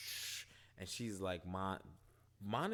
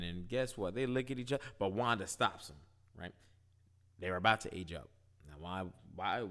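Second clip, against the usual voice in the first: ordinary delivery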